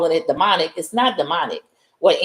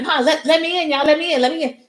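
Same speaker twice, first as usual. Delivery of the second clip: much higher, pleading voice; begging to be let in; 10 semitones higher, faster than usual. Retold or imitated. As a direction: whine like a desperate man at the door. Turned man begging at door.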